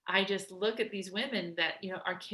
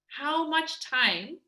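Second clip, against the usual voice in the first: ordinary delivery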